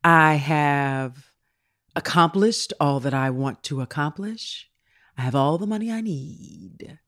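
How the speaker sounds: very slow, low, soft pronouncement; Jim Carrey. Retold or imitated. Host imitates actor announcing retirement, slow and solemn. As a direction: draw each word out softly, pausing between. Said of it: "This is how he talking".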